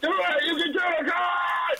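high, pushy mock gym shout; hammed-up gym bro cheering on a partner; gym jock yelling. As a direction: yell like a meathead spotter urging a lift. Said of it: "I, like, scream and yell".

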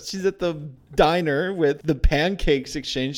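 usual speaking voice

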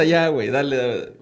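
ordinary talk

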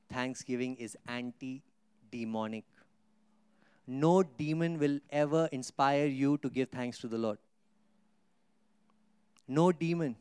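ordinary talk, nothing marked

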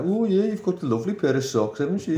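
high-pitched comic commentary voice; Cousin [name]'s voiceover. Acted. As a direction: put on a high, silly Brummie dubbing voice. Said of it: "[name] will be voicing over".

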